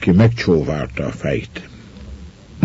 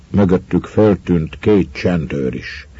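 plain reading voice

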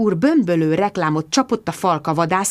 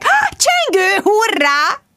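very high, drawn-out whoop; elated cry; 3 dB louder, much slower than usual. A narrator's reading. Bridget hears the doorbell at last, elated. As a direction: squeal it with sudden delight. Doorbell rings while waiting for Mark.

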